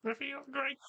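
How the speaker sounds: very high, tiny creature voice; cute voice. Acted. Game master answers as Sir Rubius when asked how he feels, cute high voice. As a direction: squeak it out as a cute little character. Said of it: "Does he still have the cute voice?".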